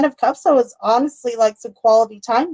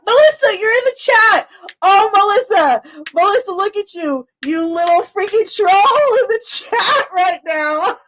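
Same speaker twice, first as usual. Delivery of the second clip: excited; 8 semitones higher than usual. Real, not acted. Greeting Melissa in the chat, high laughing squeal.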